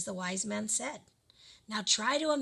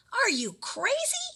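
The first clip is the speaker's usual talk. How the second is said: high, slow furious yell at her husband; wife yells in disbelief, pitched way up; 14 semitones higher, 3 dB louder, slower than usual. A narrator's reading. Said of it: "yelled the woman".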